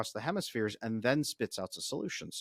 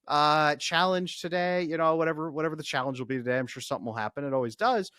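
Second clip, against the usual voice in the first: brighter and louder, a bit excited; upbeat recounting; 4 semitones higher, 5 dB louder than usual. Retold or imitated. Husband telling wife about his podcast, mildly excited. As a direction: tell her it was so cool, pitched up. He answers wife's question.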